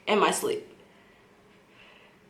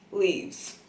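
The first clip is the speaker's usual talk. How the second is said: ordinary delivery, nothing marked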